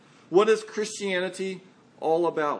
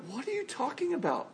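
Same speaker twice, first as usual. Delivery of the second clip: high, hushed disbelief; floored by the excuse; incredulous challenge; 8 semitones higher, 7 dB quieter than usual. Acted. Congregation rebuking the host for the excuse, incredulous.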